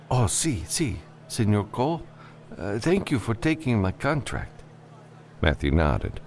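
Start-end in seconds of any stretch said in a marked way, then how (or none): 0.1-4.6 put-on high-pitched, nervous Spanish-accented client; nervous client Vicente. Client thanking the freelancer he hired, high and nervous. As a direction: play him high and jittery.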